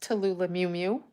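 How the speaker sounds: normal talking voice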